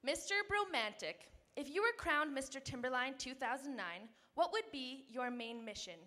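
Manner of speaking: normal talking voice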